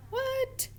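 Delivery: squeaked up high in playful disbelief; mock surprise. Teacher pretending shock at a silent GH. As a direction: act amazed for the kids.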